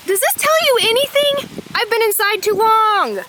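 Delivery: giddy high-pitched excitement; freaking out. Real, not acted. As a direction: squeal it up high with real joy. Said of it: "I'm freaking out".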